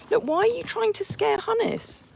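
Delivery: high-pitched telling-off; playful scold at a horse; squeaky mock reproach. Real, not acted.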